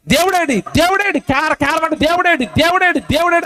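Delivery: high, repeated wail put into words; insistent high-pitched mock baby cry; imitated newborn's cries. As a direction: mimic a crying baby calling out for God. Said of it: "ఏడుపులు అర్థం ఏంటి తెలుసా".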